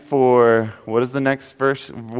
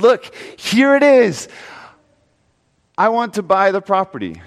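eager buyer; 4 semitones higher than usual. Acted. Man eagerly offers to buy.

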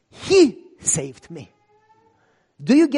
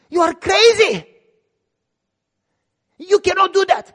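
higher, louder disbelieving cry; shocked he'd die for him; incredulous outburst; 11 semitones higher, 9 dB louder than usual. Retold or imitated. Persecutor stunned at the pastor's offer, incredulous shout.

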